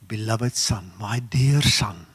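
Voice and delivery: ordinary talk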